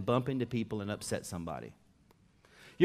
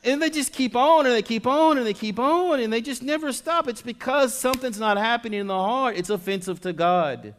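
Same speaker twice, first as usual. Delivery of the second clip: louder, driving sermon voice; full force preaching; 10 semitones higher, 8 dB louder than usual. Acted. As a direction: preach it hard to the congregation. High, loud preaching build.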